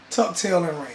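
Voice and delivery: ordinary delivery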